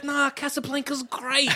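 high, slow, mock prim verdict; old-timer Captain America. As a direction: play the square old soldier. Captain America as old-fashioned man.

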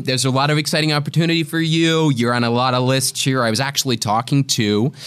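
put-on American schmoozing; American agent. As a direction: sell it like an American talent agent. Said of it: "fantastic impression of your American agent".

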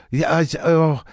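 put-on hesitant worker in a vague foreign accent; Armenian-or-Italian style foreign accent, halting; broad foreigner accent. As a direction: fumble for English words in a generic foreign accent. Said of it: "a broad-based foreigner accent".

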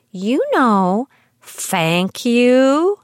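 mock child voice: "thank you" with F instead of TH; child's lisp. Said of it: "trouble distinguishing between F and T H".

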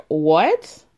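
loud rising mock-shocked what. Dorit reacting to Kyle's story about Tom, mock shock. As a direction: act out a stunned, questioning reaction.

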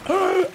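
sharp, high gulping gasp; sudden gasp for air. Acted. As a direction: gasp as if yanked under water. Acting out being pulled under.

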